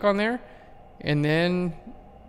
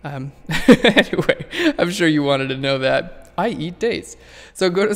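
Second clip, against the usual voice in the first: laughing; 6 dB louder, slower than usual. Real, not acted. Laughs at her own mouth mishap.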